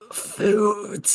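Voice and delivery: high, thin and faint dog voice; a faint, starving little voice; weak voice. Acted. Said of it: "a weak voice".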